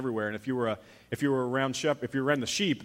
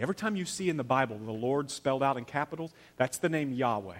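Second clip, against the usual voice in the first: normal talking voice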